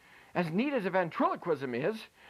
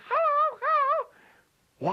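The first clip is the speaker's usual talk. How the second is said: very high squeaky puppet voice, louder; Daisy puppet voice; 5 dB louder, much slower than usual. Pretend. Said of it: "and she says".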